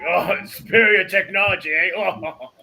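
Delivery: loud, high jeer breaking into laughter; mock the boast, then laugh. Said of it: "rolling on the ground laughing".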